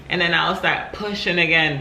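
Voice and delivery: ordinary delivery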